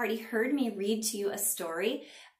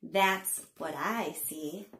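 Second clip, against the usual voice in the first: slow, sing-song picture-book reading; punchy, drawn-out storytime narration; read-aloud to children; 5 dB louder, slower than usual. A narrator's reading. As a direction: read it to little ones with big pitch swings and pauses.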